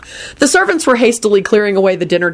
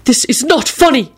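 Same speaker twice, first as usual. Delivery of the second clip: high-pitched indignant outburst; wrathful shout; 8 semitones higher than usual. A narrator's reading. Mocked man scolds the laughing table, wrathful. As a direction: protest angrily, pitched well up. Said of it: "Parks shouted wrathfully".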